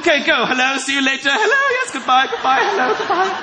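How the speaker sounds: fake casual greetings. Sneaking past waiter acting casual.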